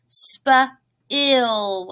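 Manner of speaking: "Spill" split slowly into spuh and ill; sounded out. Shown as a demonstration. Teacher showing the vowel inside a word, "Spill" broken slowly apart. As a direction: stretch "Spill" in two parts, spuh... ill. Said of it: "Do you hear that I sound when I say spill?".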